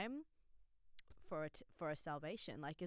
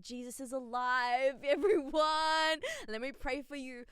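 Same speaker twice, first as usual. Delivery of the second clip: mock preacher calling out, louder and higher; pretend loud proclaiming; 9 semitones higher, 11 dB louder than usual. Imagining telling the hills about Jesus, mock loud proclaiming. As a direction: belt it like a street evangelist, half joking. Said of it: "I was out loud and proud".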